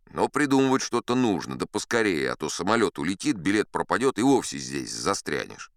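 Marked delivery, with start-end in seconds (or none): none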